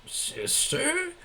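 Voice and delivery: high, startled Vader squeak on sister?; sudden high shocked echo; shocked question. Acted. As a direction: let the voice shoot way up in disbelief.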